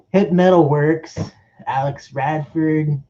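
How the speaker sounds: ordinary talk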